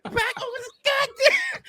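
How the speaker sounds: mock exasperated yell. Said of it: "I'm like".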